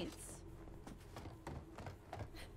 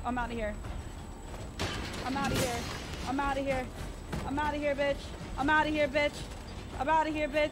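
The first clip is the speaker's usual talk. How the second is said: louder and higher with each repeat; panicked escape; 5 semitones higher, 7 dB louder than usual. Real, not acted. Player running from a monster, panicky rising yells. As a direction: chant it as you run for your life.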